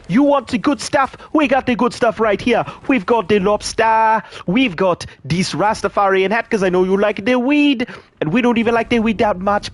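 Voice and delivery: high, loud hammed-up Jamaican accent. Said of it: "Do your best Jamaican accent".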